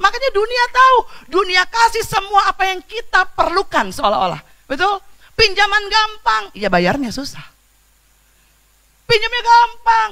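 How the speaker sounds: high, loud pulpit delivery, slowing on key phrases; fiery preaching. Acted. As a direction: preach it to the whole hall at full force. Preaching about easy loans.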